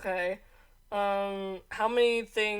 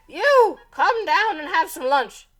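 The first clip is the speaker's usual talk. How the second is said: loud witch voice; 6 semitones higher, 7 dB louder, slower than usual. Acted. Witch answers Zelda.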